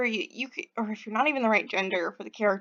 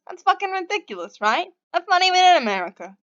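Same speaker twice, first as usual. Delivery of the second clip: put-on high, exasperated Boston accent; Denver; 7 semitones higher, 4 dB louder, faster than usual. Stranger griping about his parents' naming, exasperated Boston accent. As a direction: rant indignantly in a bad Boston accent. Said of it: "my terrible Boston accent".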